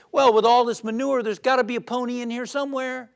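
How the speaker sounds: put-on a delighted boy sure of his pony; gleeful little kid. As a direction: optimistic twin answering the psychiatrist, bright and quick. Optimistic kid punchline.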